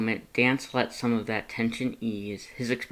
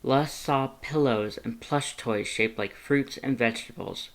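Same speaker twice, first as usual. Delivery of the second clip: ordinary delivery, nothing marked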